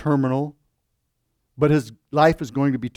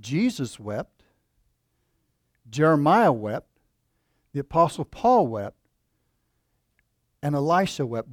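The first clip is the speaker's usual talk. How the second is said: preacher intoning who wept; solemn litany. Acted. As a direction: lay each wept down deliberately. Preacher's slow litany of weeping.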